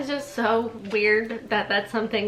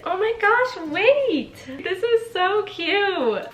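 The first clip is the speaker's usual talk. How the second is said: high, excited squeal of delight; 10 semitones higher, 4 dB louder, much slower than usual. Real, not acted. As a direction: gush over it like a thrilled fan. Delight at finding old entry.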